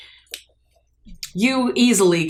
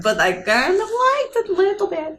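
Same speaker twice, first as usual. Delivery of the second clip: playful ouch; 6 semitones higher than usual. Acted. Playful pain reply in character.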